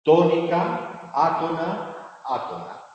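tónica slow and loud, átona, átona falling away; teacher marking the dactyl aloud; stressed, unstressed, unstressed. Shown as a demonstration. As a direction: chant the dactyl beats slowly. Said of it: "pues decimos".

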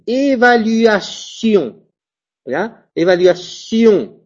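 évaluation with tion as sion, stretched; évaluation lentement; slow demo. Shown as a demonstration. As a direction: say évaluation slowly. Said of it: "la prononciation un S".

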